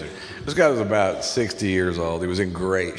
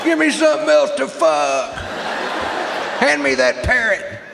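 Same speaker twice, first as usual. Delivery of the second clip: wild shouting rampage, climbing high; frenzied yelling; 8 semitones higher, 3 dB louder than usual. Acted. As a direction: bellow it like a crazed hunter.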